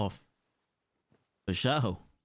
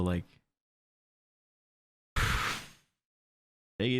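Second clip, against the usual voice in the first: usual speaking voice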